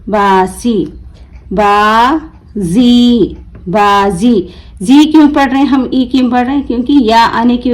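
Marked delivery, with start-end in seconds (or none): 1.5-3.4 बाजी very slow, "baa", pause, long "zee"; broken into syllables. Shown as a demonstration. Teacher breaking the word for learners, बाजी stretched as baa... zee. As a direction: draw out बाजी as two separate syllables with a z. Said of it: "तोड़ तोड़ कर पढ़ूंगी".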